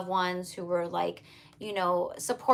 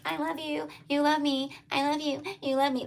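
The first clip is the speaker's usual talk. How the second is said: higher, lovestruck partner voice, sing-song; a lover gushing to their person, higher and sing-song; 7 semitones higher than usual. Retold or imitated. Reader voicing the channeled lover, higher and lovestruck. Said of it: "Someone is all like".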